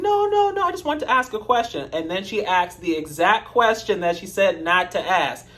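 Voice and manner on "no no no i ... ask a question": mock high protest. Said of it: "She's like".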